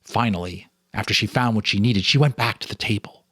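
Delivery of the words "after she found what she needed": ordinary delivery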